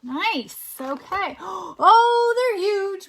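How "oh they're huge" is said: high gasping wonder; mock gasp. Parent amazed at huge dots for child. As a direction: gasp at how big.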